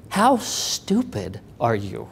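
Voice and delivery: slow, low, stunned rebuke, softly acted; upset boss, retold. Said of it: "really upset. And he's like".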